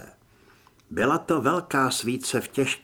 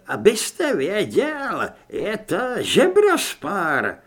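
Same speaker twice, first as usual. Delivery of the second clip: put-on high, slow old priest voice; shaken old priest, correcting; 5 semitones higher, 5 dB louder, slower than usual. A narrator's reading. (vysoký pomalý hlas starého kněze)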